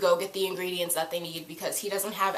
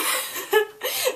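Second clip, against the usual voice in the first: high giggle. Real, not acted. Laughing at her own fangirling.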